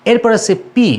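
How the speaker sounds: usual speaking voice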